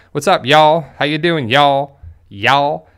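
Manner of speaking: "What's up, y'all?" with a light southern twang, "y'all" drawn out; a Jersey guy trying out a southern drawl on "y'all". Shown as a demonstration. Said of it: "a little southern drawl and a little twang".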